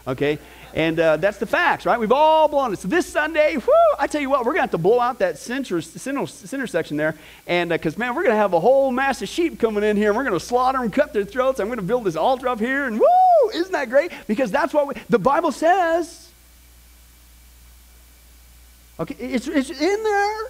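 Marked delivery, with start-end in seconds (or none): 2.0-7.1 higher, louder fake excitement; mock enthusiasm. Pastor teasing congregation about sacrifices, mock excited. 8.0-20.4 higher, louder fake excitement; mock enthusiasm. Pastor teasing congregation about sacrifices, mock excited.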